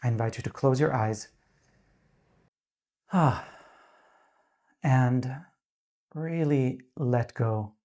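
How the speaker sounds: calm, unhurried meditation voice with long pauses; guided meditation. Real, not acted. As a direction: gently guide them into grounding, leaving space between phrases.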